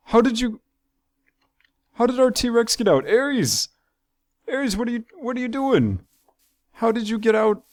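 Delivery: high, startled disbelief at the escape; alarmed surprise. Real, not acted. T-Rex escaped its enclosure.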